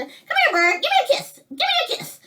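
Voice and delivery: very high, squeaky bird mimicry; parrot voice. Recalling the sister's talking bird, high squeaky parrot voice. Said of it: "My sister taught him to say that".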